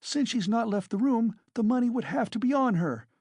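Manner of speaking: frantic railing. A narrator's reading. Katerina rails at landlady.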